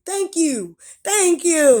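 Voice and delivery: loud, high mock gratitude; sarcastic thanks. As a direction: throw exaggerated, angry thanks back at them. Sarcastic thanks for being called angry.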